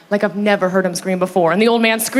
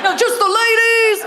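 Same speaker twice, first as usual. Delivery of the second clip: crowd call-out; 11 semitones higher than usual. Pretend. Comic narrows her call to the ladies, hyped-up. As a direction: call out high to the whole room. Calls out to audience.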